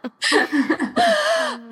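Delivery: a high burst of real laughter; bright giggle at herself. Real, not acted. Laughing at own stubbornness.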